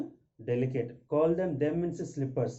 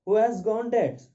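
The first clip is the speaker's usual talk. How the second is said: alarmed question; 8 semitones higher, 7 dB louder than usual. Acted. Abel asks, acted.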